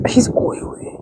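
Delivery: hushed whispered aside; whispered remark about the dog. Real, not acted. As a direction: breathe it as a secret.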